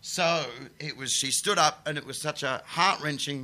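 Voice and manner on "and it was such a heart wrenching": usual speaking voice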